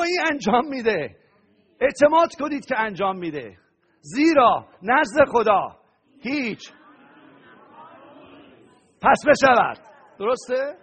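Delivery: loud, high preaching voice; fervent sermon climax; full-force preaching. Real, not acted. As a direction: drive the promise home to the crowd.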